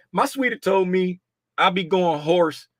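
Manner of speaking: normal talking voice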